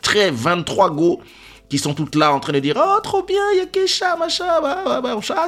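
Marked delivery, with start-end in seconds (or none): 2.8-5.5 put-on very high excited girly squeal; mock girly squeals; squealing girls. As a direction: mimic the girls shrieking with delight. Girls greeting excitedly, mocked.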